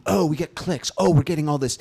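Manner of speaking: high, loud imitation of thrilled marketers; mock excitement. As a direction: gush over the numbers, mockingly.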